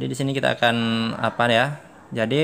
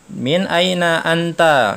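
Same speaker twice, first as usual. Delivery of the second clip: "Min aina anta?" drawn out slowly, higher and louder; slow model phrase; 5 semitones higher, 5 dB louder, much slower than usual. Shown as a demonstration. Teacher demonstrating the question, slow and emphatic. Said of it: "katanya di sini kan".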